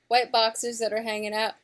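usual speaking voice

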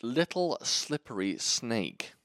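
slow careful S sounds with a lisp. Real, not acted. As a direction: sound out each S slowly. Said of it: "Say s".

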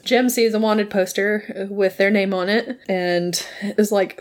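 ordinary delivery

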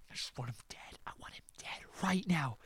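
intense whispered venom; seething whisper. Real, not acted. Fan wishing the villain dead, seething whisper. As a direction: drop to a furious whisper.

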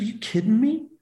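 high-pitched retold disbelief; incredulous. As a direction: throw it back as if the question is absurd. Retold incredulous reply to bench question.

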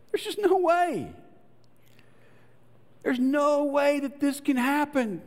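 acted, squeaky-high incredulity; incredulous. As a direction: pitch it up high, as if it's impossible. Voices Sarah's disbelief at promise.

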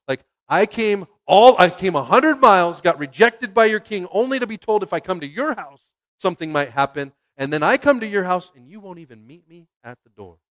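indignant Naaman. Acted. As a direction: vent like a snubbed guest. Naaman's angry complaint acted.

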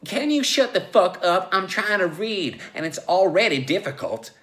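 high, loud exasperated yelling; angry yell. Acted. Joe yells through the glass while reading, angry. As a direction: yell it, fed up with interruptions. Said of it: "Joe yelled at Logue".

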